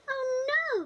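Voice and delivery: high dismayed "Oh no!"; cried out. Acted. Little girl sees her ball fall into the pond, crying out. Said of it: "cried the little girl".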